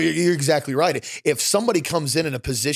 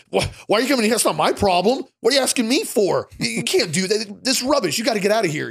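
higher, louder exasperated doctor voice; mock angry doctor; 7 semitones higher, 3 dB louder than usual. Doctor scolding a patient about his elbow, hammed-up outrage. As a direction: play a doctor shooing the patient out. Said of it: "And he goes".

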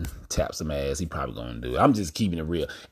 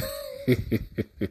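high falling laugh; 3 dB quieter than usual. Real, not acted. Laughs at own remark.

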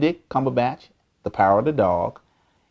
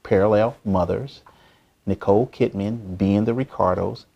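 ordinary talk, nothing marked

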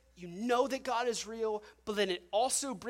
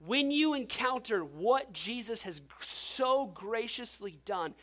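raised, higher preacher's voice driving the point home; preaching at full force; 4 semitones higher, 5 dB louder than usual. Acted. Pastor pressing the congregation on God's grace, forceful preaching. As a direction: build it up like a pulpit climax.

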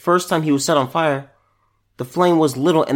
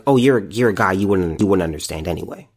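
lower, offhand brush-off; mock dismissive woman; 8 semitones lower, faster than usual. Quoting women who dismissed his question, lower mock brush-off. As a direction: imitate them brushing him off.

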